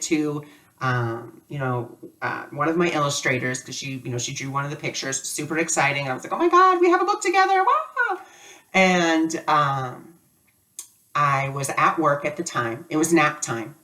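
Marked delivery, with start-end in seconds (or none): 6.3-8.2 much higher and fast, gushing; a giddy, high rush of joy; thrilled squeal. Retold or imitated. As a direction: squeal with delight over the book. Thrilled about the first book copy.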